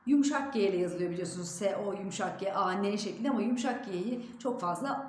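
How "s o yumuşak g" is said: ordinary talk, nothing marked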